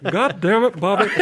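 mock Southern accent. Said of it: "got a southern accent all of a sudden".

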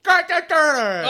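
high, loud druid war cry; incantation yell. Acted. Druid casting on the travellers, yelled incantation. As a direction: yell out a strange spell. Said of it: "he yells some kind of thing".

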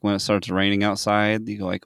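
ordinary delivery

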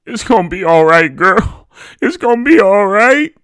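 high, loud, anguished comforting; emotional consoling. A narrator's reading. Aunt consoling the sobbing mother.

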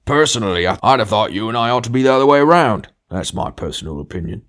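"personally" hit high and loud, easing at the end; emphatic opinion. A narrator's reading. Big ghost disagreeing with his companion, emphatic. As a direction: assert it forcefully, starting on a stressed "personally". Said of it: "with an emphasis which contradicted the ordinary meaning".

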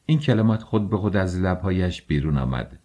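usual speaking voice

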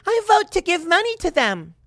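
high, sing-song mocking politician; hammed-up politician impression; mock AOC voice.

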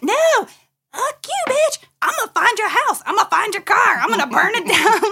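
high, loud mock threat; shrill hammed-up catty threat; mock girl threat. Mock girls threatening rival.

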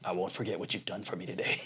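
low, quiet, solemn thanks; Liam Neeson. Retold or imitated. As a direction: vow it gravely, imitating the movie customer. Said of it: "Liam Neeson was fantastic".

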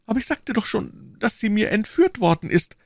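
high, plaintive shopkeeper voice; anxious prisoner. Acted. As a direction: plead your case, pitched high. Prisoner protests under questioning.

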